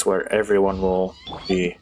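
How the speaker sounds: ordinary talk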